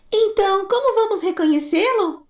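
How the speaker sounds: put-on very high small child voice; little kid goat. Kid goat asking its mother, very high. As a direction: squeak it like a tiny goat.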